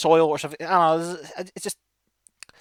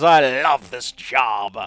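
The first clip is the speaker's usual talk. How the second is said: drawn-out relish in a louder voice; gleeful grinning dwarf; 6 semitones lower, 4 dB louder, much slower than usual. Acted. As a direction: savour each word with a broad grin. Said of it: "His broad grin says".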